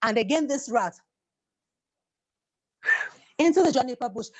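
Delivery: plain reading voice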